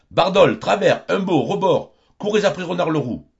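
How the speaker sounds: loud, high calls to the hounds; calling the dogs. Acted. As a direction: summon the dogs and send them after the fox. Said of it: "Constant appelle son matin".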